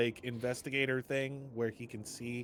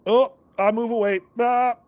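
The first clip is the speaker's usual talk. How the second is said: startled; 9 semitones higher, 12 dB louder than usual. Real, not acted. Startled by floating lights.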